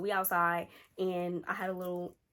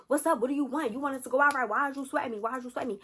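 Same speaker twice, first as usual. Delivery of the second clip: higher-pitched, badgering retort; acted irritated boyfriend; 4 semitones higher than usual. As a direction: imitate a fed-up boyfriend firing questions. Acting irritated boyfriend on phone.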